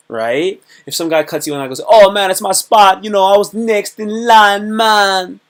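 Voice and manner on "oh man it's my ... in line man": aggressive claim. Retold or imitated. Guy cutting in, claiming spot.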